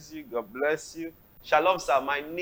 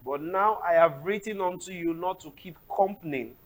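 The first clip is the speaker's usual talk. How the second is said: loud, declamatory Bible reading; scripture reading; 4 semitones higher, 10 dB louder than usual. Acted. Host reads Corinthians aloud for the pastor, loud and declamatory. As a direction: read the verse out like scripture in church.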